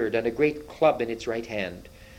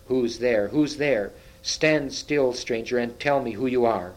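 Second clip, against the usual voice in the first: usual speaking voice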